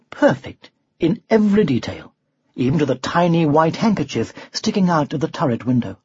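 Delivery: ordinary delivery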